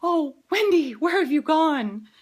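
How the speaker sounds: high, loud, hammed-up swooning lament; Victorian heroine. Laboring mother mocking herself as Victorian heroine, melodramatic wail. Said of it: "in some Victorian saga".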